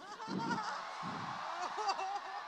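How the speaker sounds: a sudden high burst of laughter. Real, not acted. (ज़ोर की ऊँची हँसी)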